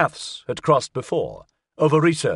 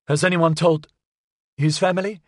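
raised pitch, halting, holding it together; trying to keep composure; 5 semitones higher than usual. A narrator's reading. Olga asking after the boy's family, trying not to lose it. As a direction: hold back distress, pausing midway. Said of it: "trying not to lose it".

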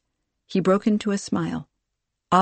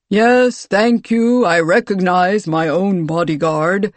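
booming baritone; 7 dB louder, slower than usual. A narrator's reading. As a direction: boom it out, cutting the herald short. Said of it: "his voice a booming baritone".

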